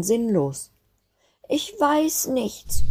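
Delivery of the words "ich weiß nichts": put-on much higher, slow tearful child; sobbing. Snowflake sobbing to her siblings, high and slow. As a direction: sob it out, lost and helpless. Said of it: "schluchzte sie".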